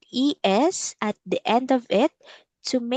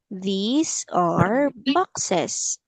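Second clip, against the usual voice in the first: very slow, stretched words with rising tones; teacher's drawn-out repeat-after-me delivery; slow model sentence; much slower than usual. Shown as a demonstration. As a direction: stretch each word for a learner.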